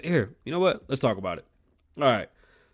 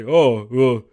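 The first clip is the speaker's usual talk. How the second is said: louder, put-on mocking voice of a vegan; 8 dB louder than usual. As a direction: mimic a smug vegan to ridicule him. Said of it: "in your mind, you say".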